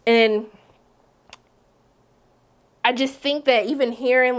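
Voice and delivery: usual speaking voice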